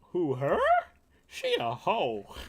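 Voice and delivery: put-on loud, high Vietnamese-accented catchphrase, drawn-out ending; Vietnamese boss impression. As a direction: mimic the Vietnamese manager's loud mock question. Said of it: "funniest shit he would say was like".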